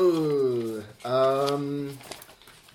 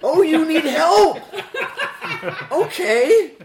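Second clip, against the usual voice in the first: very high, loud teasing reply; mock surprise; 12 dB louder than usual. Player answering a plea for help, high and teasing. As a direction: answer the plea with exaggerated, amused pitch.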